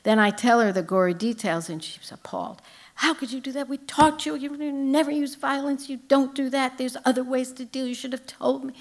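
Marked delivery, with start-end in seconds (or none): 3.0-8.7 a hint of a shocked, scolding mom, higher; lightly voiced mother telling her off. Mother scolding daughter for stabbing, mildly voiced.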